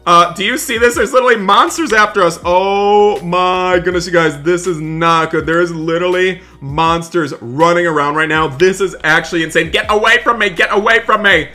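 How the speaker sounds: loud, high, frantic panic; panicked. Acted. Groundskeeper flees the monsters, loud frantic panic. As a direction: babble in terror while running.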